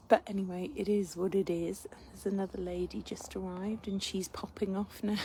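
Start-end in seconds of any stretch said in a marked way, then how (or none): 1.9-5.2 soft hushed aside; whispering. Real, not acted. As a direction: whisper it quietly. Lady arriving at the tree.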